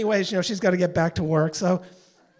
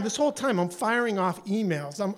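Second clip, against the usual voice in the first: ordinary delivery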